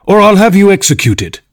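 high, forceful royal threat; threatening king. A narrator's reading. The king threatens the forgetful Hatter, forcefully.

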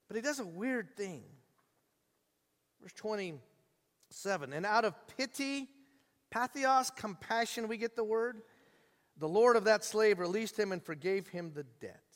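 ordinary talk, nothing marked

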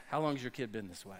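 quick, low and soft, laughing through it; fast amused aside, laughing; laughing quip. Pretend. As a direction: toss it off with a chuckle. Jokey modern Jesus quip.

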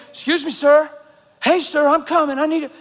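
high, loud voice flagging down a stranger; calling out for help. Retold or imitated. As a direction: imitate someone calling out to get attention. Woman calls out to him at station.

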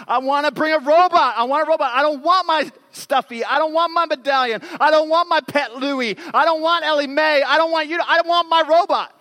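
put-on high, loud wailing child's tantrum; screaming tantrum. Pastor imitating his daughter's screaming tantrum before school, high and loud. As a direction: scream it like a six-year-old losing it.

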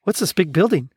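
shivery stutter on the first sounds; stammering with cold. Acted. Gonzo alone out in the cold, shivering stammer. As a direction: act chilled, halting and catching on consonants.

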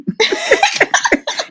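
high burst of laughter; amused, high-pitched laugh. Real, not acted. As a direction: laugh at your own warning. Laughs at own warning.

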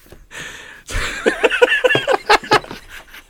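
long high fit of laughter; helpless giggling fit. Real, not acted.

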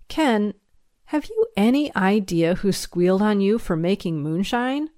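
ordinary delivery

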